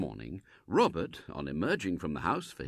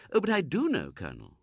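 higher, placid mother's reply; calm and homely; lightly voiced mother; 8 semitones higher than usual. Mrs Brown answering the Colonel, lightly voiced and placid.

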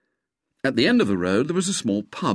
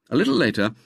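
ordinary delivery

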